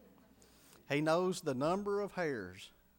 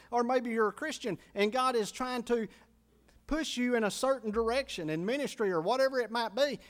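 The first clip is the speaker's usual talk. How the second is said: raised, high mock complaint; preaching at full force; 6 semitones higher, 4 dB louder than usual.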